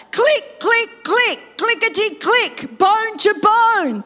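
high, punchy, rhythmic clicks; acted clicking bones. Preacher acts bones joining, high and rhythmic. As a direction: punch each click high, then stretch the last phrase.

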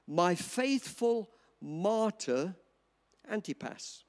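my faithful martyr, drawn out very slowly; slow reverent reading, quiet at Antipas; slow scripture emphasis. Shown as a demonstration. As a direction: savour the words faithful martyr. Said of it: "listen to this".

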